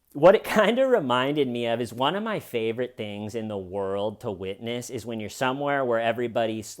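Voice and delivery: ordinary talk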